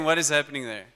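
ordinary delivery

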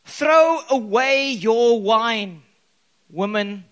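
slow, loud scolding order; stern command. Acted. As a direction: order her off sharply. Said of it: "he demanded".

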